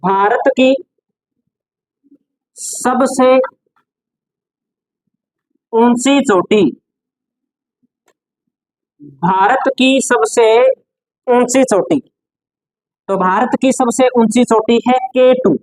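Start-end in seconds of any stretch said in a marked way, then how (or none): 0.0-12.0 word groups with long pauses between; dictation. Shown as a demonstration. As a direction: dictate for note-taking, phrase by phrase. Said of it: "note लगा के लिख दो". (श्रुतलेख की तरह रुक-रुक कर)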